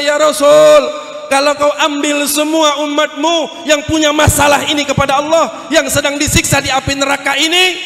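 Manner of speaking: high, pressing acted appeal; loud protest. Angel of hell protesting to the Prophet, loud and high. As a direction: cry out in protest to a superior.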